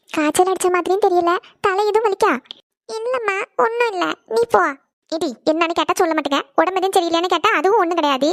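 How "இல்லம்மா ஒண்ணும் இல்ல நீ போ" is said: tearful. Acted. Daughter hiding why she cries from mother, tearful. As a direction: wave mother away through held-back tears. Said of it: "நீ எதுக்கு மக்களே இப்படி ஏங்கி ஏங்கி அழுதுட்டு இருக்க".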